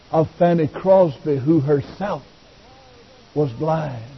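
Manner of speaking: low, soft, measured reverence; hushed preaching. Real, not acted. Preacher recalling the blind hymn writer, hushed and low.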